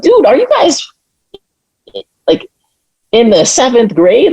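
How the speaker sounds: high incredulous voice; mocking disbelief. Speaker mocking Proud Boys initiations, high and incredulous. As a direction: scoff at them like they're children. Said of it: "I'm like".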